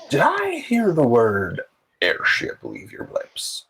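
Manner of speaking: slow, drawn-out airship captain, starting high; Captain Seamus. Acted. Captain leaning in on overheard airship talk, intrigued role voice. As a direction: lean in slowly, pitch dropping by the end. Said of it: "he kind of leans in and goes".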